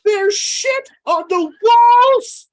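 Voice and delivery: high, slow, drawn-out mock wail; movie quote voice. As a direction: stretch it out high like a cartoon. Quoting movie line, mock.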